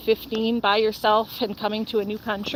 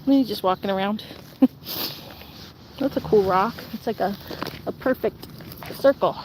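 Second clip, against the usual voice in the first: normal talking voice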